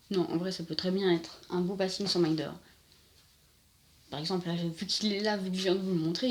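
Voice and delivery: usual speaking voice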